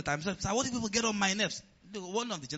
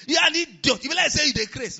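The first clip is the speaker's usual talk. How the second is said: high, loud mock insult; loud imitation of a rude outburst; 9 semitones higher, 8 dB louder than usual. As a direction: fling the insult in mock anger. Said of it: "You will never hear me say".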